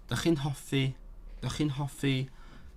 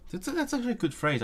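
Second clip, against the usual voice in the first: delighted; 12 semitones higher, faster than usual. Real, not acted. Delighted by a Welsh phrase.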